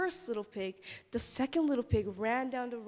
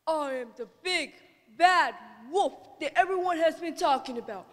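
wolf voice; 4 semitones higher, 6 dB louder than usual. Acted. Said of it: "said the wolf".

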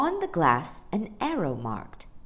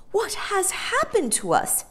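frightened; 3 dB louder than usual. A narrator's reading. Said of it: "shivering with cold and surprise".

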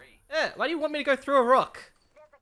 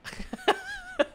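high giggle; 5 dB quieter than usual. Real, not acted. Laughing at teammate's name.

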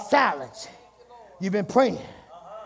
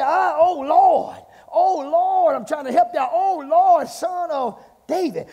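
higher, drawn-out cry to the Lord; pleading cry; 7 semitones higher, 7 dB louder, slower than usual. Acted. Preacher voices desperate woman calling on Jesus, pleading. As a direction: cry out like a woman begging for help.

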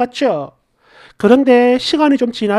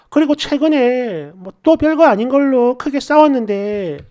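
usual speaking voice

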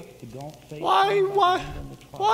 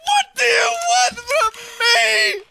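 same words yelled high and loud; furious shout; 5 semitones higher, 5 dB louder than usual. Acted. Yelling the question back at Stim, furious. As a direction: blow up on the repeat.

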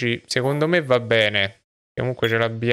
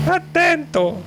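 high falsetto shout; slower than usual. Acted. Wife character warns.

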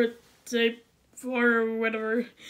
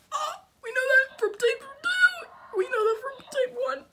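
excited shout; 12 semitones higher than usual. Real, not acted. Gamer crying out over a clue, excited. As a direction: shout out the discovery.

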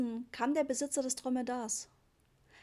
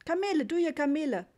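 urgent, higher and louder hawking; insistent trader; 6 semitones higher, 6 dB louder than usual. A narrator's reading. Trader insistently pushes Papa toward the camels. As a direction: press the tourist, don't let go. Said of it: "sagte der Mann eindringlich".

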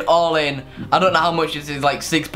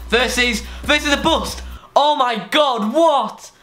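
high, astonished outburst; real high-pitched shock; shocked excitement; 8 semitones higher, slower than usual. Real, not acted. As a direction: voice leaps up in disbelief at the win. Winning bet comes up, shocked reaction.

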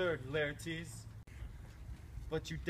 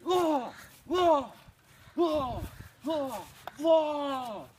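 loud, high battle shouts with each thrust; shouted fencing cries; 9 semitones higher, 9 dB louder, much slower than usual. Acted. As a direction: yell each lunge like a duelist.

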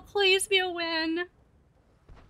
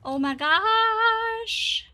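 slow, drawn-out cry rising high; stunned exclamation; 6 semitones higher, much slower than usual. Real, not acted. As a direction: stretch it out as your pitch climbs. Tense moment in a game.

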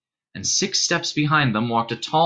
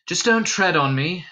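mildly sighed, higher-pitched reply; sighing; 5 semitones higher than usual. A narrator's reading. Sleepy Dunstan letting the stranger stay, lightly sighed. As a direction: give in with a touch of weariness. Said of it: "sighed Dunstan".

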